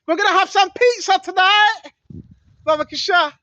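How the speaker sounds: mock them gleefully, letting the code word land; mock elite insider. Preacher mocking the elite ordering pizza, hammed-up sly voice.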